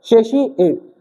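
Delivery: सेशिएट with SH in the middle; सेशिएट, middle syllable softened to "shee"; pronunciation with SH. Shown as a demonstration. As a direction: say सेशिएट with "shee", not "tee". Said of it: "क्या बोलते हैं?".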